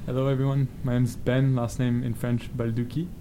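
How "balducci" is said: ordinary delivery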